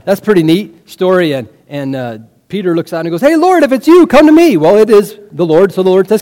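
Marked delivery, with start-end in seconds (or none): 3.2-4.6 high, loud impulsive call. Acted. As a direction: call eagerly across the water. Peter calls to Jesus over water.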